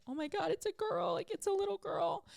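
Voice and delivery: reliving the moment she learned it was a daughter; retold joyful amazement. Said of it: "I was like".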